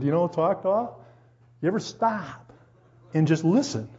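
normal talking voice

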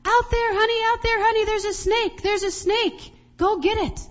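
high, loud re-enacted panic; frantic wife yelling, acted out; frantic alarm. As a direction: urgently send your husband after the danger. Wife frantically tells husband about snake.

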